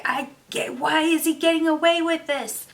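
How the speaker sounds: loud, high indignant question; furious at an injustice in the book; outraged. Retold or imitated. Reader outraged the villain gets away, loud and high.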